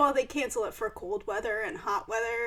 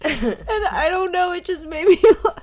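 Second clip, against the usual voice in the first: laughing; 6 semitones higher, 9 dB louder than usual. Real, not acted. Still laughing at podcast start.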